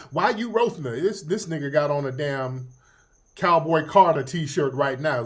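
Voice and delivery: ordinary talk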